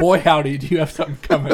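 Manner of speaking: loud, laughing mock warning; laughing exclamation. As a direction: blurt it out through laughter. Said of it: "I was like".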